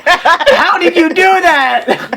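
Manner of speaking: cracking up while reading; laughing. Real, not acted. Reading the fic, cracking up. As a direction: laugh through the words.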